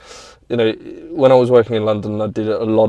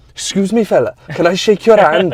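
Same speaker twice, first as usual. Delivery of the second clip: high, sing-song Welsh impression; Welsh accent; 8 semitones higher, 4 dB louder than usual. Welsh racer greeting the speaker at the revival, in a Welsh accent. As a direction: imitate an eager Welshman, pitched up. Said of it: "I know he's not that Welsh".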